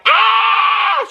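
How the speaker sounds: sudden high, loud imitated scream; mock scream. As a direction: burst out in a shrill fake scream at the camera. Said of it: "She starts screaming".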